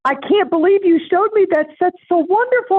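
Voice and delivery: thrilled woman gushing over the hairpiece; amazed gushing. Retold or imitated. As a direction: gush like she's thrilled. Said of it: "And she said".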